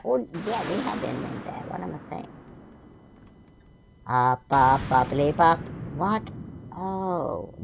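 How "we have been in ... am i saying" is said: ordinary delivery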